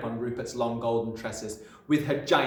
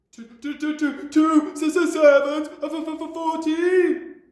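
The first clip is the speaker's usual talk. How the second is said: put-on high, stuttering, gasping child; terrified stammer; 8 dB louder, slower than usual. A narrator's reading. Boy hanging by his hair, stammering. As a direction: stammer it out in terror. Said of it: "gasped Rupert".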